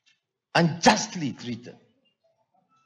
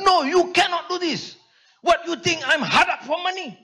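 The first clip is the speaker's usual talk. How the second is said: mock angry lawyer; 10 semitones higher than usual. Lawyer Karpal scolding the jailed speaker, a mild mock rebuke. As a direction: play the irate lawyer, pitched well up. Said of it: "Dia jumpa saya, dia marah".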